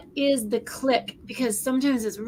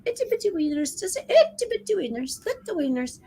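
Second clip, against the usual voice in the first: put-on higher-pitched, playful British accent; mock British accent; 7 semitones higher than usual. Imitating a girl's tic in a high mock British accent. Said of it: "now she's got this British accent".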